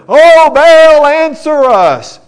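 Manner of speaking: loud, high cry stretched out slow; shouted plea. Acted. Prophets of Baal crying out loudly to their god. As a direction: call out desperately to an absent god. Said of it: "they shouted".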